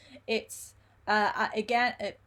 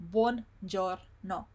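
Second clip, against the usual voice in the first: slow, separated syllables; much slower than usual. Shown as a demonstration. Teacher models the long word for learners, slow syllables. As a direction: split the word into three slow beats. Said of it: "you go little by little".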